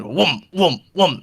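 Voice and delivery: swooping sound-effect whooms; imitated coffin noise. Coffin follows the hoverboard, repeated whooming.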